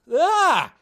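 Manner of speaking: loud high yelp of disgust; shocked yelp. Real, not acted. Vlogger seeing a face jacket, loud disgusted yelp. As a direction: yelp as if recoiling.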